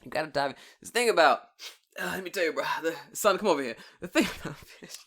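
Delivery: loud pretend fishing mentor; hammed-up old-hand fishing advice; know-it-all fisherman. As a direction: lecture a youngster with mock expertise. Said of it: "like, a professional fisherman".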